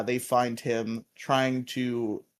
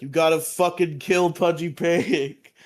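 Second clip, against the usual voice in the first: mock commanding Zordon; 4 semitones higher, 5 dB louder than usual. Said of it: "Zordon's like".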